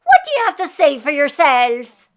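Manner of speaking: a wife scolding her husband for the mess; Eileen scolding. A narrator's reading. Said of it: "she said".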